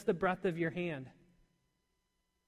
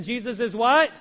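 louder, firm call to the congregation; preacher rallying his people to answer, loud and firm; with conviction; 2 semitones higher, 9 dB louder than usual. Shown as a demonstration. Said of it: "Let's say that with conviction".

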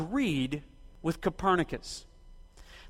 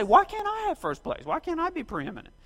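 high, petulant self-pity; whiny inner protest; 8 semitones higher, 3 dB quieter than usual. Retold or imitated. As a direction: voice the ego whining for first place. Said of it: "everything within us wants to rise up against that fact and say".

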